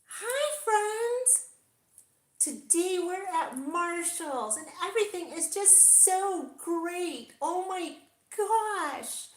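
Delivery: very high, loud, sugary influencer squeal; fake bubbly vlogger voice. Shown as a demonstration. Vlogger demoing the fake voice she refuses to use, squealing high. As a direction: put on a phony excited YouTuber voice. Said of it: "let me try and do it".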